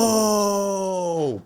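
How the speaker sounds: high, drawn-out "oh" of dawning understanding; sudden realization. Retold or imitated. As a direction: it all clicks at once.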